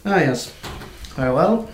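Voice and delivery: een brisk, zakelijke klerk; brisk clerk. Acted. GM voices dwarf lawyer Carol.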